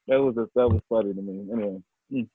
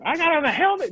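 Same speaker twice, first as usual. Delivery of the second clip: much higher squeaky come-on; high mock voice. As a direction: go way up and beg playfully. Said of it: "That's what".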